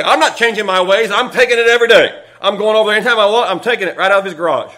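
loud, fast, brazen refusal; defiant refusal. Acted. Pastor Phil brushing off the witnesses, loudly defiant. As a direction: boast that you'll keep taking it.

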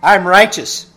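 mock smug self-congratulation; smug self-praise. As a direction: crow about your own goodness. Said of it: "should I pat myself on the back and say".

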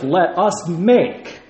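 slow, weighty "Let us make" with "us" leaned on; stress on us. Shown as a demonstration. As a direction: dwell on "us" to make the point. So when God says.

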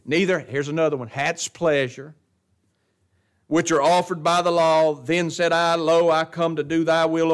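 loud, driving pulpit reading; forceful scripture reading. Real, not acted. Pastor reading Hebrews to his congregation, loud and driving. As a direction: drive through the verses with preaching force.

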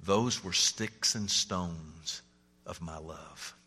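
low, slow, fading murmur; mumbled punchline. Acted. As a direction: let it sink, slow and quiet. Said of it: "And then she said".

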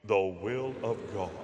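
very low, soft, mock-solemn intonation; deep preacher voice. Shown as a demonstration. Preacher asking listeners to help announce the topic, deepened voice. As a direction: sink into a deep, grave pulpit voice. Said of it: "deepen his voice".

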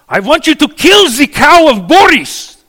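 high, loud, exaggerated Russian peasant. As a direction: belt it out like a desperate peasant. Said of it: "said Ivan".

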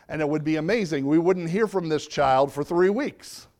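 ordinary talk

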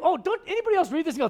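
ordinary talk

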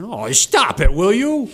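loud, high exasperated snap; mock-exasperated stop it; exasperated outburst. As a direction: throw up your hands and shout it. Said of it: "I'm saying".

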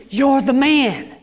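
preacher voicing fervent acclaim; exultant acclamation. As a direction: hail him loudly as the promised one. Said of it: "this Greek woman is saying".

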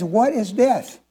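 usual speaking voice

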